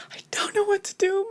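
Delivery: high whispered panic, retold; panicked whisper. She retells losing the computer, high panicked whisper. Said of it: "I started to panic".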